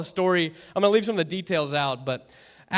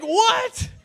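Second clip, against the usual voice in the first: high-pitched incredulous yelp; hammed-up, very high disbelief; mock shock; 4 dB louder than usual. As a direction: imitate a stunned listener. Acted shocked reaction of Ashley.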